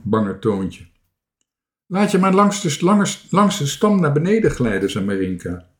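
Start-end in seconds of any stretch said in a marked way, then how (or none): 1.9-4.9 raised, higher voice; calling up. A narrator's reading. Marinka calls up to Toontje, high raised voice. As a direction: call instructions up into the tree.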